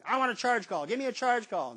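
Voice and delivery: lightly acted burly fan clamoring; burly fan demanding. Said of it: "this big burly guy behind me".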